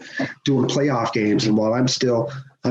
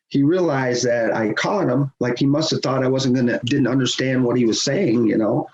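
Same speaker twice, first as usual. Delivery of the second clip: ordinary talk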